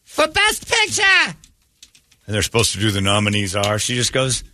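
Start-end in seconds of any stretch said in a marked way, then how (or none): 0.0-1.0 very high, tipsy starlet voice announcing; drunk Elizabeth Taylor. Retold or imitated. Host imitating a drunk actress announcing the big award. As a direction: ham up a tipsy old star at the mic. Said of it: "And she goes".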